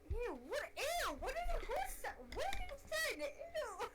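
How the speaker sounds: high, swooping ew; mock disgust. Friend reacting to what was just said, high mock disgust. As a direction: squeal it high, aghast.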